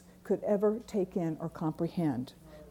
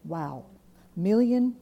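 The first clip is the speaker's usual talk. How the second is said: ordinary talk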